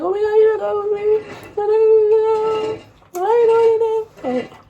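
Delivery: exaggerated high-pitched crying to a friend; mock wailing. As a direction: wail it like a hysterical breakup call. Re-enacts crying calls to friends.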